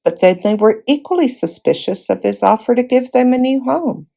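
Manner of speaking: ordinary delivery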